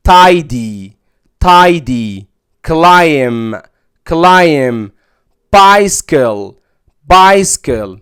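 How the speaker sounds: title, tiny, tidy, climb, bicycle with ai, each said twice; teacher drilling ai; long i. Shown as a demonstration. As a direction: clear repeated models. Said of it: "بينطق مد وياء".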